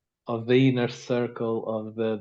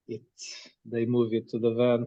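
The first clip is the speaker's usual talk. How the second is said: ordinary talk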